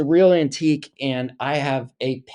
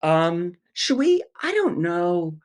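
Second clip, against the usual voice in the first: hesitant director; 6 semitones higher, slower than usual. Retold or imitated. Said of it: "A director would be like".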